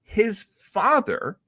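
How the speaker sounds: ordinary talk, nothing marked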